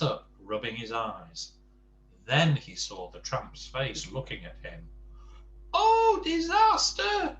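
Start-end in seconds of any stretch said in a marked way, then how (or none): 5.7-7.4 very high, loud, drawn-out wail; screaming in panic. A narrator's reading. Mister Jelly seeing the tramp's face, high panicked scream. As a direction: squeal it slowly in panic. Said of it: "he screamed".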